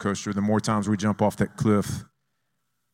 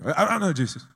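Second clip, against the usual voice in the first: high, stammering last-second balk; hammed-up nervous protest; mock panic; 6 semitones higher, faster than usual. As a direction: back out in comic fright. Jumper balks at the count.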